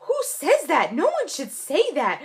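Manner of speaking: high-pitched, exasperated imitation of a mother. Daughter imitating her mom appalled at the doctor, exasperated. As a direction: protest in high, incredulous disbelief.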